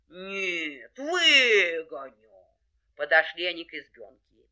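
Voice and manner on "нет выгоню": very slow vow, swelling louder; determined bear. Acted. Bear contradicts the hare, determined. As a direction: drag the promise out heavily. Bear insists he will manage.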